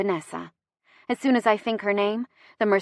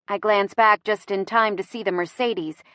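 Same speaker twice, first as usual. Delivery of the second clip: usual speaking voice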